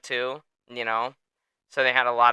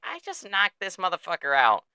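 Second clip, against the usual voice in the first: calm Dustin; 8 semitones higher than usual. Retold or imitated. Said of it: "Dustin's just extraordinarily calm".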